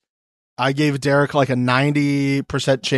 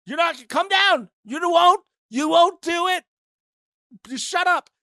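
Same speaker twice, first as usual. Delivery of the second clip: much higher, goading taunt; mocking a contestant daring him to come down; 13 semitones higher than usual. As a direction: egg him on in a high teasing voice.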